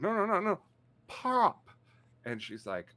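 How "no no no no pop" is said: high-pitched, hasty protest; acting out a panicked correction, pitched way up; retold frantic correction. Customer correcting a cashier who misheard pop, frantic.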